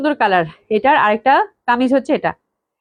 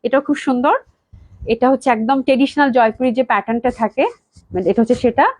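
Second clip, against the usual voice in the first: normal talking voice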